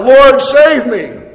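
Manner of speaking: loud, slow plea for rescue; crying out. Retold or imitated. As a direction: call out desperately, slowly. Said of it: "he cried out".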